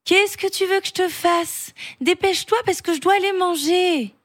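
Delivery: put-on high child voice, straining for patience; exasperated little boy. A narrator's reading. Julien must go and eat, strained patience. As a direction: hurry him along as an impatient child. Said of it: "en faisant un effort de patience".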